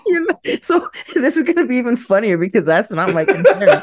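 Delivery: laughing through the sentence; high giggly voice, can't stop laughing. Real, not acted. Laughing at misunderstanding.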